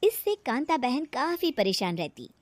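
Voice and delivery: ordinary delivery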